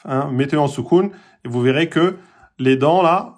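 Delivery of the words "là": usual speaking voice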